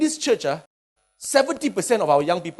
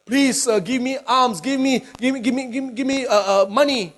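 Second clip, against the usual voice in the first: begging beggar; 7 semitones higher, 3 dB louder than usual. Acted. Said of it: "walked past a beggar".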